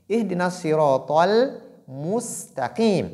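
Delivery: slow chanted Quran recitation. Shown as a demonstration. Teacher recites the verse for students. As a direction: recite with measured melody. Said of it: "on dit".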